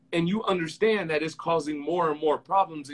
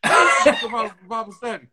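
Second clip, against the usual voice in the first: mock mom excuse; 2 semitones higher, 7 dB louder than usual. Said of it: "She's like".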